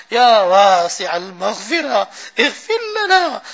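begging for forgiveness, on the edge of tears; voice breaking in prayer. Real, not acted.

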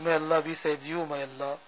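ordinary talk, nothing marked

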